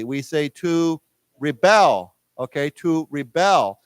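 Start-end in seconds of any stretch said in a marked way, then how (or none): 0.5-2.2 "to rebel" slow, stress on the BEL; stress on second syllable. Shown as a demonstration. Teacher showing the verb form, "rebel" stressed on BEL. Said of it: "we pronounce it slightly differently". 2.7-3.8 "to rebel" with weight on the BEL; stress on second syllable. Shown as a demonstration. Teacher repeating the verb, "rebel" stressed on BEL. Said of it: "accent goes on the second syllable".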